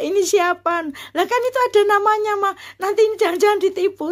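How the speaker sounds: high, fast retold worry; retold fearfulness. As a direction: rattle it off high, afraid of being tricked. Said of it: "saking takutnya saya gitu ya".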